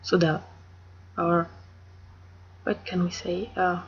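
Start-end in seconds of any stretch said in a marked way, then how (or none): 0.0-0.4 "soda" said the French way; French-style "soda"; French pronunciation. Shown as a demonstration. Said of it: "In French we just say".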